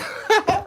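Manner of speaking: sudden shrill burst of laughter; high cackle. Real, not acted. Ranting to shunning friends, a high cackle. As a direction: cackle high in mock triumph.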